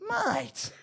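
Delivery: very high singled-out questions; high comic coach impression; imitating the coach. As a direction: tick off a checklist in a squeaky coach voice. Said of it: "He goes".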